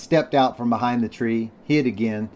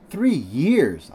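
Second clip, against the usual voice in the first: higher, swooping exclamation; astonished cry; 6 semitones higher than usual. A narrator's reading. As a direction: cry out in mild amazement. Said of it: "I cried".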